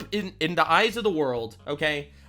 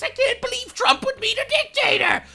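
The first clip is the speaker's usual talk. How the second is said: much higher, whiny mock of critics; mocking libtard whine; 3 dB louder than usual. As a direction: sneer it in a silly high voice. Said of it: "all the libtards losing their shit".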